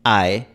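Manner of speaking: ae con final menos cerrado; diptongo inglés ae. Shown as a demonstration. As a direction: deslizar de a hacia una E abierta. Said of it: "Este diptongo inglés". (ae, diptongo inglés con final abierto)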